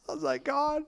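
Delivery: high, giggling through the words; real laughter breaking through; laughing. Real, not acted. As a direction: laugh out the quote helplessly.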